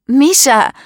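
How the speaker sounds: high, loud call for a friend. A narrator's reading. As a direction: shout the name urgently. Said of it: "ruft Paula". (laut und hoch gerufen)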